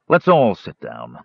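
slow, firm instruction; calmly decisive, unhurried. A narrator's reading. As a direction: take charge, measured and steady. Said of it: "Tanis said firmly".

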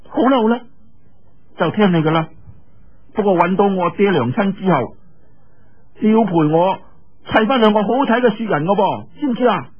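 put-on high bright-pitched girlish voice, teasing; playful young girl calling out. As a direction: call to him like an excited young girl. Yue Lingshan giving in playfully. (岳靈珊高聲嬌俏咁叫林仔)

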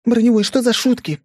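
put-on high, loud, startled girl; startled young woman. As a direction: yelp it, high and indignant.

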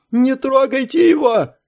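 high, slow screaming plea; terrified shriek. Acted. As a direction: shriek in panic for the child. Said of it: "визжала ... обезумевшая от ужаса Виктория". (визг от ужаса)

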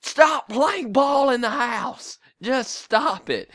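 high, slow scolding mother voice; scolding mom. Retold or imitated. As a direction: nag like an angry mom. Mom scolding kids over broken things.

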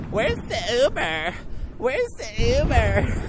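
mocking girly whine, slow. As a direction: imitate them with a teasing sneer.

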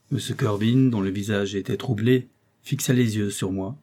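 ordinary delivery

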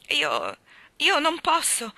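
grieving refusal. A narrator's reading. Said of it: "si dolse Anna".

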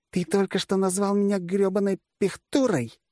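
high-pitched offended accusation; indignant outburst. A narrator's reading. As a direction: throw the insult back, voice pitched high. Generalissimus outraged at being called infantry. (возмущённо, высоким женским голосом)

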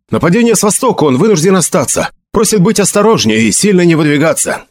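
high, urgent messenger voice; hurried report. A narrator's reading. Messenger relays the captain's warning to Felix, hurriedly. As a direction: pass the message on briskly. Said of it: "скороговоркой заговорил посланник".

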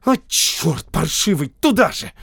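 loud exasperated cursing; muffled shout. A narrator's reading. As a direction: shout the curse, straining upward at the end. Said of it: "Наконец Рязанка глухо крикнула".

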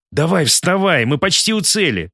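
rally him to his feet; confident urging. Acted. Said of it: "уверенно заявил Артур".

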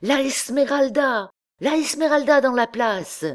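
high, ringing announcement; excited cry. A narrator's reading. Young rascal calls to comrades from the window, excited cry. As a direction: cry the news out, pitched far up. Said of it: "cria tout à coup un de ces jeunes drôles".

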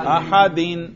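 "احدین" ending in a clear -din; جر کا تلفظ. Shown as a demonstration. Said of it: "جر پر کرو". ("احدین" کے آخر میں دو زیر، یعنی اَحَدِن)

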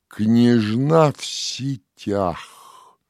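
plain reading voice

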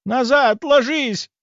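high, urgent warning shout; крик. A narrator's reading. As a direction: cry out a sudden warning. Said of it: "Вдруг издали послышался крик". (пронзительный крик-предупреждение)

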